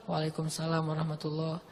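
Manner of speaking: reply under your breath so he can't hear; answered softly. Retold or imitated. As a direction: companion quietly answers the Prophet's salam. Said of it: "saya pelankan suara".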